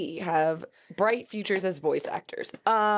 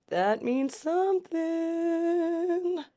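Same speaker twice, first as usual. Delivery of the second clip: much higher, very slow, SOMETHING held long; exaggerated drawn-out hint; 10 semitones higher, much slower than usual. Shown as a demonstration. Reactor hamming up a symbol clue, exaggerated drawn-out hint. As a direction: stretch SOMETHING right out on a high note.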